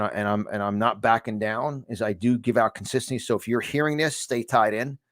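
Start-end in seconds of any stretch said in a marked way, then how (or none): none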